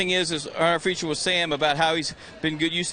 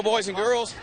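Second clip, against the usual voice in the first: ordinary talk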